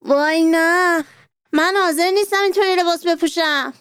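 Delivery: hammed-up child's outcry; mild cry of protest. A narrator's reading. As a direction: refuse with a mock cry. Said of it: "فریاد زد".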